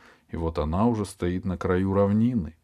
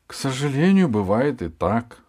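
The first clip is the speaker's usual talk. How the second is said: sighing; 6 semitones higher, 5 dB louder than usual. Acted. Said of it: "вздохнула Алиса".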